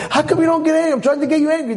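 high-pitched frustrated pleading; exasperated wife. Acted. Wife trying to anger her calm husband, exasperated. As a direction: pester him, pitch way up.

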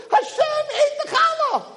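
pitched high, gleeful boasting; excited. Acted. Wife celebrating that God ate her challah, elated. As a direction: crow with joy over her baking. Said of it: "She's all excited and dancing".

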